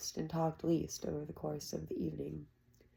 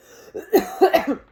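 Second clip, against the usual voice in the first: cough; 11 semitones higher, 12 dB louder than usual. Acted.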